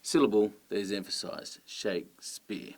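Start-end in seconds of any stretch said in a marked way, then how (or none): 1.6-2.7 slow "Shakespeare", SHAKE stressed, speare falling away softly; stressed then unstressed. Shown as a demonstration. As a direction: spell out "Shakespeare" slowly as strong then weak. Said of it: "so stressed and unstressed".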